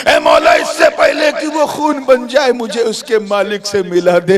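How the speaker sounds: high, loud plea to God, easing down; urgent prayer. Acted. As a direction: cry out in supplication. Youth's acted plea to God. (मौला से ऊँची फ़रियाद)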